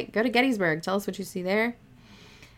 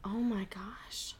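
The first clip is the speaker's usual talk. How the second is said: slow, soft unvoiced whisper; awed whisper; 9 dB quieter, slower than usual. Real, not acted. Listener reacting to the ghost tape, hushed whisper. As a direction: let it slip out in a hushed breath.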